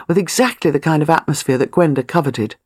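ordinary delivery, nothing marked

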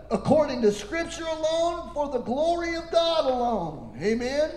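ordinary talk, nothing marked

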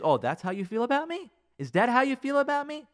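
rising, indignant questioning; hurt disbelief, acted out; offended retort. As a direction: take it personally, pitch climbing. Spouse takes offence at criticism.

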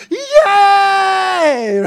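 high, loud, drawn-out cheer; kid-on-Christmas joy. Acted. Preacher imitating kids on Christmas, gleeful shout. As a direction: squeal it like an overjoyed kid. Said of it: "Like kids on Christmas".

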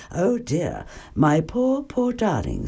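soft, tender mother's comfort; soothing Mother Bear. A narrator's reading. Mother Bear consoles Baby Bear, soft and tender. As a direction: hush the child with soft sympathy. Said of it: "said Mother Bear".

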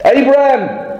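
high-pitched call from on high; God calling Abraham. Acted.

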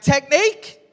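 high, loud disbelieving echo of the word; incredulous. Retold or imitated. Rower shocked by his mate's advice, loud incredulous echo. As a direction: throw the word back, stunned and indignant. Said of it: "And I went".

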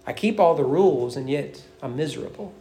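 low, dejected confession, fading to very soft; dejected, voice dropping away; despair. Acted. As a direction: let it sink into quiet misery. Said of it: "what it also brings with it is despair".

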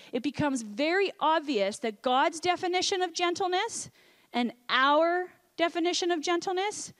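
ordinary talk

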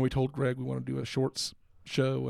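normal talking voice

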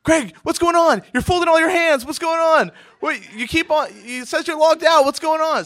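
high-pitched, loud, panicky mom voice; frantic mom. Retold or imitated. Frantic mother on phone.

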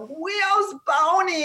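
put-on high, drawn-out little girl's whine; toddler with speech impediment. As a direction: imitate a small child who can't say R's. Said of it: "she had a speech impediment".

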